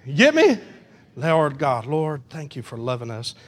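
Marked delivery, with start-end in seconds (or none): 1.1-3.3 low, soft pastoral prayer. Real, not acted. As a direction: speak humbly to God in thanks. Leads closing prayer.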